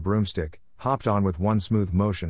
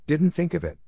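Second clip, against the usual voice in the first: higher-pitched mock repetition; mocking echo; 9 semitones higher than usual. A narrator's reading. Draco echoes Ernie's excuse, mocking. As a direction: throw the excuse back at him, pitched up.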